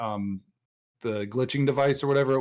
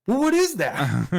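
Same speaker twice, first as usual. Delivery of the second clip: slow, high, puzzled question; baffled Loki; 8 semitones higher, 4 dB louder, slower than usual. Retold or imitated. Loki questioning the oral temperature machine, slow and baffled. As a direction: stretch it out, higher and baffled. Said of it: "He's like".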